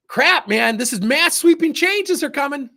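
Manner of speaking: high, loud mocking alarm; mock panic. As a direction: ham up the staff's panic about change. Mock panic of gossiping staff.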